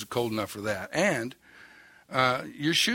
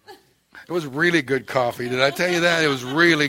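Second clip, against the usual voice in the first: laughing; 4 semitones higher, 8 dB louder than usual. Real, not acted. Joke about the lost coffee.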